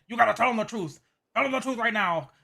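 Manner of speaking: mock-aggressive insistence; aggressive demand. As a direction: demand it forcefully, mimicking an angry man. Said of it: "he kept just being aggressive".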